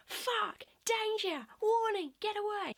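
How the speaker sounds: high, drawn-out siren-like warnings; alarm bells. Acted. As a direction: call out like a panicking alarm. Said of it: "Your nervous system is going".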